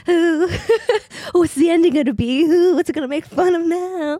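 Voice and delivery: high, loud giddy squeal; giddy excitement. Retold or imitated. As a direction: squeal with delight over a new sequel. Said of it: "And you're like".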